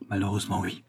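soft, subdued confirmation; hushed reply. A narrator's reading. Volpi confirms it privately to Magali, hushed.

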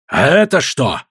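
mildly imperious retort; commanding cry. A narrator's reading. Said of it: "властно крикнул Синдан".

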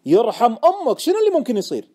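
loud, high, pleading demand; very insistent. Acted. Friend begging the trader to explain, forcefully insistent. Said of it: "سألني مرة ثانية بإصرار أكبر".

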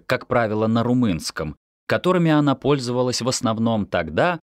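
usual speaking voice

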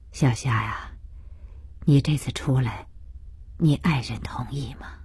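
gently probing question; elderly father, tentative. Acted. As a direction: ask quietly, with care. Voices the father asking gently.

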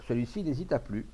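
plain reading voice

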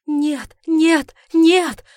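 high, loud, mounting cries; frantic shouted denial; panicked refusal. Acted. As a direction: cry out with rising desperation. Panicked refusal as artifact dies.

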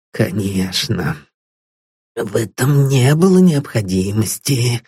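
put-on relieved old man repeats Paul's words with a nervous giggle. A narrator's reading. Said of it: "нервно хихикнул он".

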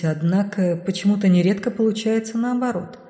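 ordinary delivery, nothing marked

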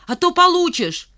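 высокий выкрик с угрозой; пригрози криком; угрожающий крик. A narrator's reading. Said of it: "крикнул Димка". (грозно кричит)

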